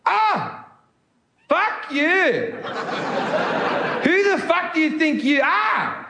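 high-pitched, indignant South African woman; South African accent. Acted. Girlfriend snaps back at accusing boyfriend, indignant South African accent. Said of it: "I will now be doing a South African accent".

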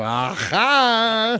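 mock triumphant exclamation; triumphant aha. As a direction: catch them out with a grin. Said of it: "you can go".

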